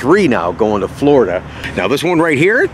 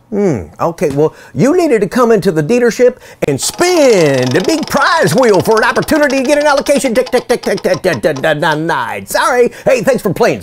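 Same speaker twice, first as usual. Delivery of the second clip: high, sing-song salesman voice, ticking like a game-show wheel; mocking car dealer; 9 semitones higher than usual. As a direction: ham up a smug dealer hosting a prize wheel. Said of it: "They're like".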